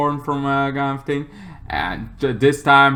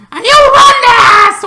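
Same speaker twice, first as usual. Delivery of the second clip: high, loud angry yell; angry shout; 12 dB louder than usual. Real, not acted. Ranting to viewers at drivers who rush orange lights, loud and angry.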